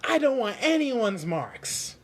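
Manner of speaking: high, loud, slow insistence; determined. Acted. Pinocchio refusing the marks, high and slow. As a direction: plant your feet and mean it.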